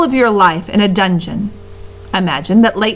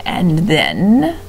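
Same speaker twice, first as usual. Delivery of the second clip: encouraging rat; 2 semitones lower than usual. A narrator's reading. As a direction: nudge him on, sly and patient. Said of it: "encouraged Roscuro".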